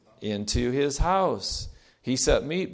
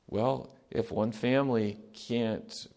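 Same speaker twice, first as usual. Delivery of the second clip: ordinary talk, nothing marked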